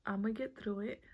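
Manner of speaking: ordinary talk, nothing marked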